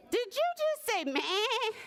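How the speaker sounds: high goat voice with a bleat; inquiring goat. A narrator's reading. Goat questioning the little bird, high bleating voice. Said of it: "inquired the goat".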